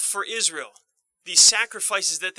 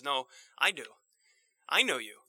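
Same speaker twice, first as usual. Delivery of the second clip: gentle reassurance; 5 semitones lower, 6 dB quieter than usual. Acted. Voicing Jesus's reassurance.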